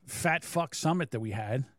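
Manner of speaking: ordinary talk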